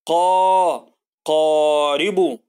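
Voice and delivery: قا then قارب very slow, the a held long; stretched قارب. Shown as a demonstration. Teacher modelling a long vowel in قارب, very slow. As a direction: drag out the a in قارب. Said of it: "نقول مع بعض".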